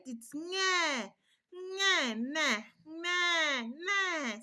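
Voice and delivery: nge said high, with no H sound before; hunger cry nge. Shown as a demonstration. Mother demonstrating a hungry baby's cry to mothers, high nge. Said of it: "the one for hunger is nge".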